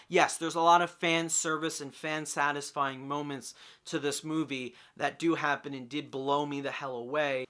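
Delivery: ordinary delivery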